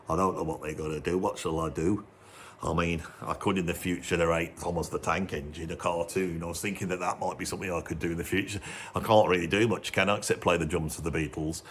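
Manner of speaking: a glum drummer muttering low about his future. Retold or imitated. [name] voiced worrying about future.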